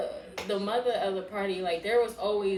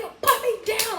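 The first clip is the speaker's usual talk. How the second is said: high, loud mock yell; retold protest; 12 semitones higher, 7 dB louder than usual. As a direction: squawk it as if being carried off. Retold protest at being carried off.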